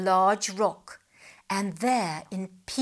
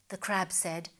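ordinary delivery

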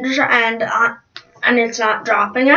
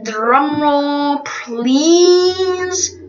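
slow, stretched-out grand announcer delivery; show host announcement; 2 semitones higher, much slower than usual. Acted. Host calling for a drumroll before a new contestant, grand announcement. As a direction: draw out each word to build suspense.

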